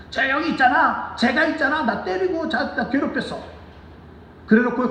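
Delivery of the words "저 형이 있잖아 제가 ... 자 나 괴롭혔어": put-on high childish tattling voice, much higher. Little boy telling on big brother to his father, high and whiny. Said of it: "그 아버지한테 고자질해요".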